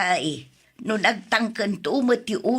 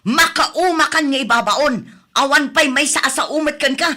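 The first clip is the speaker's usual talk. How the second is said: much louder, higher shouting tirade; furious yelling; 6 semitones higher, 7 dB louder than usual. Acted. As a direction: blow up at the grandchild who never listens. Grandmother yelling at grandchild. (napigsa a panagpukkaw iti pungtot)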